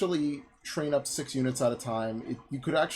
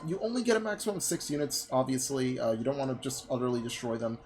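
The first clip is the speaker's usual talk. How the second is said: usual speaking voice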